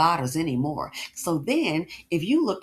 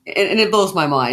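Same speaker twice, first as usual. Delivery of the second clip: louder, fired-up agreement; loud heated agreement; 3 semitones lower, 9 dB louder than usual. Real, not acted. Host backing up the guest, loud and heated. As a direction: burst in agreeing hard.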